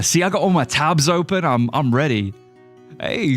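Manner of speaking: ordinary delivery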